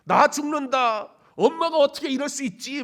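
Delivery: high, very loud cry of betrayal; panicked wail. Acted. Preacher voices a falling eaglet, panicked wail. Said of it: "뭐 별의별 생각이 다 들 거예요".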